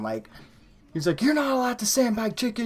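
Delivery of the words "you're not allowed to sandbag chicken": high-pitched mock rant; imitating manager flipping out. Retelling his manager's outburst at the cook, high-pitched mock tirade. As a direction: flip out like an outraged boss, pitched way up. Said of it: "flipping out on him".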